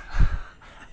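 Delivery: breathy burst of real laughter. Real, not acted. Laughing at a friend's teasing, a real loud burst. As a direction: laugh out at the joke.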